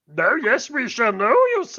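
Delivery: put-on puppet sage voice; Yoda. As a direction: imitate a wise little alien master. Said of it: "My, my Yoda voice is".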